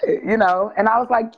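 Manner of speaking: ordinary talk, nothing marked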